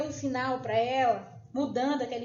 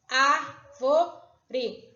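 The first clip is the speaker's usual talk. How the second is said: "Árvore" slowly split ár-vo-re; syllable by syllable; 4 dB louder than usual. Shown as a demonstration. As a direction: sound out "Árvore" in three separate beats. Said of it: "Eu abri a minha boca três vezes".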